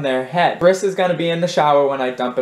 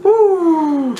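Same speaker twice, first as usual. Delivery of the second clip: excited cheer; 13 semitones higher, 5 dB louder than usual. Real, not acted. Winning rock paper scissors.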